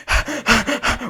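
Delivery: heavy, audible huffs of breath; winded panting. Acted. Runner climbing station stairs with luggage, out of breath. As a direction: act puffing from a hard climb.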